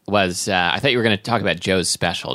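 ordinary talk, nothing marked

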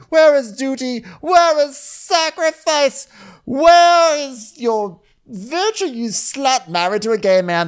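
very high, loud, drawn-out shouting; hammed-up queen screaming her accusations; mock furious tirade. Acted queen's tirade at its peak.

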